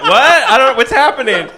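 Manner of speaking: loud, high confused outburst; mock bewilderment. Father thrown by cross-examination, mock bewilderment. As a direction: act out a flustered yelp, half laughing. Retelling witness confused by questioning.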